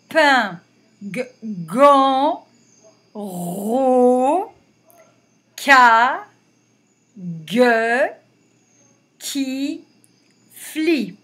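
put-on loud, separated syllables for children; syllable reading drill. Shown as a demonstration. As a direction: read each syllable clearly to the class. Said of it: "une fois que vous avez bien lu les syllabes".

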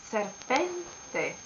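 serpente with PEN stressed and lengthened; stress on middle syllable. Shown as a demonstration. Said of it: "the accent".

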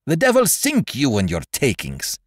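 high-pitched furious interruption; sudden fury. A narrator's reading. Russell turning on the mate with a curse, in sudden fury. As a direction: break in with an angry curse. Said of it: "broke in with a sudden access of fury".

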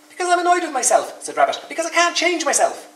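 high, loud, exasperated rabbit voice; annoyed Rabbit. Acted. Rabbit complaining to Bear he can't change, high and loud. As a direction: grumble loudly up high.

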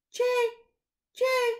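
loud, high jay cry; blue jay call. Acted. Narrator imitating the blue jay's call, loud and high. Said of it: "The blue jay says".

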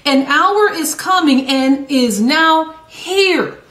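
slow, deliberate scripture reading; slow recitation. Shown as a demonstration. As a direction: lay each word down slowly. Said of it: "Let's repeat that again".

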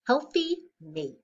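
plain reading voice

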